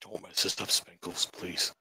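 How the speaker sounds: hushed urgent plea; whispering. Real, not acted. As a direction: whisper so the creature cannot hear. Player whispering to companion.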